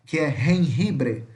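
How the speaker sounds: "jengibre" slowly, both J's throaty like R; teacher modelling "jengibre"; Spanish J sound. Shown as a demonstration. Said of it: "que é som de R".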